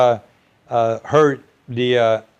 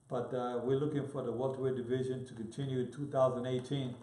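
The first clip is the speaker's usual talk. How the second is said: far from mic; 17 dB quieter than usual. Real, not acted.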